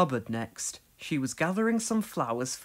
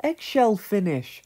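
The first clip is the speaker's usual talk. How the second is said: laughing; 9 semitones higher than usual. A narrator's reading. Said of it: "laughed Dorothy".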